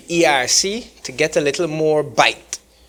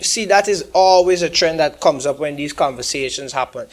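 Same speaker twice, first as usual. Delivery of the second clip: normal talking voice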